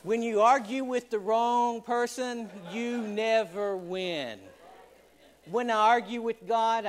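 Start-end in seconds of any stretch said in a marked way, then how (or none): 2.7-4.4 slow, drawn-out never; emphatic sermon button; slow emphatic punch. Acted. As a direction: lean on never and let it hang. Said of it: "preacher lands the point slowly".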